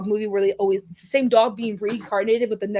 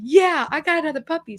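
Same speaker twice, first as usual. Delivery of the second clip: retold happy excitement, pitched higher; 6 semitones higher than usual. Daughter retelling her thoughts on getting a puppy, happily. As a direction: relive the thrill, lifting the pitch. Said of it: "I'm happy".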